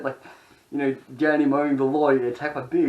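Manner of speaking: ordinary delivery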